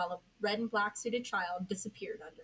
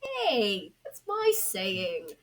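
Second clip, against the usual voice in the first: whining protest; 2 semitones higher, 6 dB louder, much slower than usual. Acted. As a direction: complain like a wronged little brother. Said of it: "Another voice whined".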